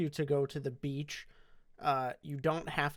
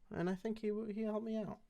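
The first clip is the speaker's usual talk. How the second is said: normal talking voice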